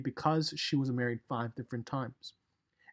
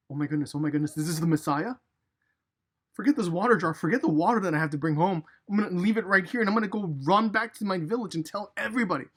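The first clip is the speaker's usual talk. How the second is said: excited; 4 semitones higher, 7 dB louder, faster than usual. Retold or imitated. Woman excited, leaving water jar.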